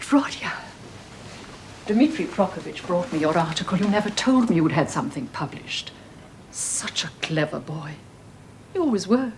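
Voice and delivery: ordinary talk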